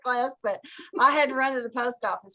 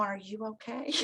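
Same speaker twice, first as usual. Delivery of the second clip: soft, unsmiling repeated check; dead serious; 3 semitones lower, 12 dB quieter than usual. Retold or imitated. Woman still asking after the fall, dead serious. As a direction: imitate her quiet, earnest question. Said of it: "so serious, she never smiled".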